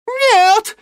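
very high wailing cry; howling no. A narrator's reading. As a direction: howl it in despair. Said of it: "взвыл Ипполит Германович".

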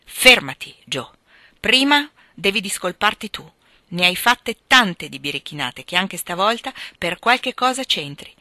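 loud, firm reprimand; forceful stern scolding; stern maternal order. A narrator's reading. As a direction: stop the child short with authority. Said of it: "con un volto che mostrava di rado".

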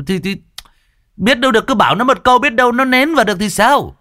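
very high, insistent excuse-making; defensive neighbour. Acted. Neighbour defends his absurd suggestion to husband, defensive. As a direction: stumble in, then argue it shrilly high. Neighbour character argues back.

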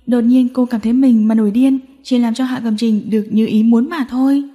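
ordinary delivery, nothing marked

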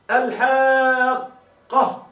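الحاقة with its long a drawn out very slowly; الحاقة said slowly, vowel stretched; stretched madd. Shown as a demonstration. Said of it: "كما نقول".

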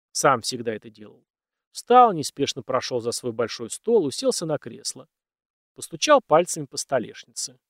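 ordinary delivery

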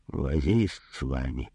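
low, slow grumble; grumbling. A narrator's reading. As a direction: grumble under your breath wearily. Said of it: "проворчал доктор". (недовольное ворчание)

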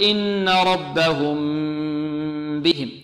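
slow chant, long nasal hum on the م of ربهم; prolonged ghunnah. Shown as a demonstration. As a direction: stretch the hummed م of ربهم, then release into بهم. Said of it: "نطيل الغنة فيها".

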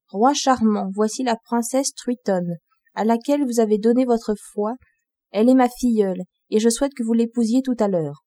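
ordinary delivery

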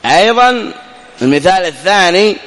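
ordinary delivery

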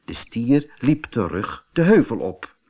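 plain reading voice

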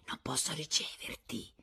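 whispered refusal from a distance. A narrator's reading. Rosanette signalling her visitor from afar, whispering. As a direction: whisper it across the room, unheard by others. Mouthed message gestured from afar.

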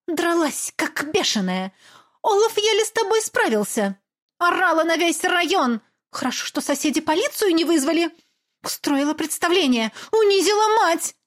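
high, accusing tirade; berating mother. Acted. Mother scolding her daughter, high and bitter. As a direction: pile on the blame.

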